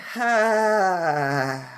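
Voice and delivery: long sighing out-breath; drawn-out "haaa" exhale; audible exhalation. Shown as a demonstration. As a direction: let the breath out in a big, audible sigh. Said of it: "audible exhalation".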